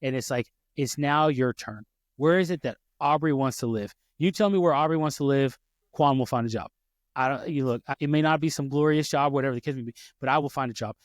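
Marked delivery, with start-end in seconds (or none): none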